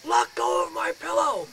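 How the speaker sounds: high, slow, strained shout; mock yell. Fighting the ghost for his pillow, high strained yell. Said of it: "I'm like".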